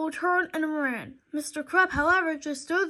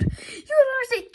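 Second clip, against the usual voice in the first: higher, pleading cry; desperate plea; 9 semitones higher, 4 dB louder than usual. A narrator's reading. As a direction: beg him to listen.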